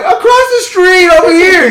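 high, loud holler; re-enacted high-pitched yell; calling across street. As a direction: shout to a distant neighbor for rescue. Retold calling out to neighbor.

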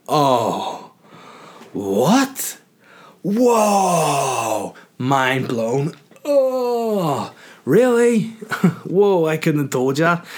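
sarcastic awe, long swooping oohs and whoas; mock amazement. Patient to dentist stating the obvious, mock amazement. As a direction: act mind-blown at the obvious, drawn out and high.

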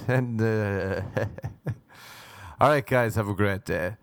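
mock vlogger chat broken by forced giggles; imitating an Instagram poser laughing at nothing; fake influencer laughing. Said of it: "why the fuck are you laughing".

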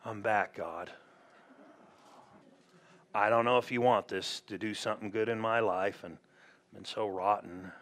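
low, soft, self-abasing mock prayer; imitating an abject sinner at prayer; grovelling prayer. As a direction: creep back to God, small and unworthy. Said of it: "when we approach him grovelly like that".

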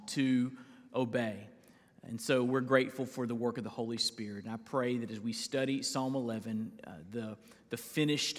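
soft, low closing prayer to God; quiet prayer. Real, not acted. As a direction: pray it gently, winding down. Pastor closing an opening prayer.

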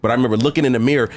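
ordinary talk

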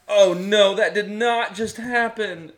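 high, loud shocked disbelief; real shock at a bad play. Real, not acted. Watching a shocking play live.

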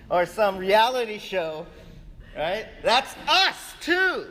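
high, loud, building preacher's call; preaching at full force. Acted. Pastor reminding the church the heroes are flawed, preaching loud. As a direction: rouse the congregation.

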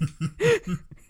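high bright burst of laughter; laughing. Real, not acted. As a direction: laugh out loud at the memory. Laughing at the memory.

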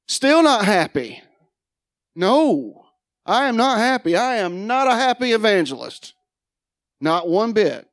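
high, loud "not happy"; emphatic declaration. Real, not acted. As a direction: hammer home his unhappiness. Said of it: "I was not happy".

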